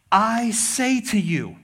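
slow, weighty pronouncement; authoritative declaration. Retold or imitated. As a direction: declare it slowly with full authority. Quotes Jesus with authority.

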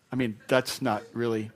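normal talking voice

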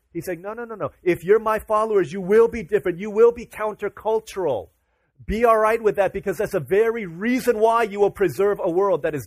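forceful sermon climax; impassioned preaching. Acted. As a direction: urge the congregation with rising passion.

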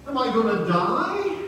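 high, loud mock alarm; panicked question. Patient pleading with his doctor, acted panic. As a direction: ask it in hammed-up dread, rising.

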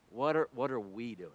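usual speaking voice

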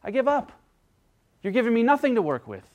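mock surrender, fed up; exasperated giving up. Giving up in conversation.

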